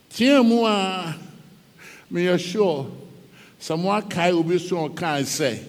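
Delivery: ordinary talk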